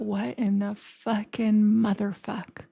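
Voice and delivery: slow, stretched-out incredulity; drawn-out swearing. Retold or imitated. Reacting to the screensaver, slow bewildered disbelief. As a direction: draw each word out in comic bewilderment. Repeats the outburst for emphasis.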